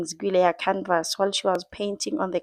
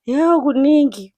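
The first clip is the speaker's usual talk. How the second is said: louder, higher Yo kuningi; excited exclaim; 6 semitones higher, 8 dB louder than usual. Real, not acted. Vlogger reacting to the crying scene, excited exclaim. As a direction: burst out at the drama.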